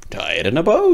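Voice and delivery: a second thinker chimes in; another thinker. Acted. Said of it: "said another".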